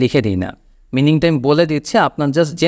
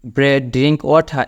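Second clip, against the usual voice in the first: slow model sentence; much slower than usual. Shown as a demonstration. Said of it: "একটু backdated".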